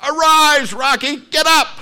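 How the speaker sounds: high, loud ringside yell; yelled cheer. Retold or imitated. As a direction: scream him back onto his feet. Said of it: "And what are you yelling?".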